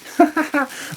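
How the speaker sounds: a quick high-pitched giggle. Real, not acted. Laughing at the lazy-buyer joke, high giggle. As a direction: giggle at your own joke.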